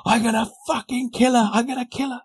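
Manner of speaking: high-pitched mock fury; retold threat in a squeaky fuming voice; mock furious wife. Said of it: "She went".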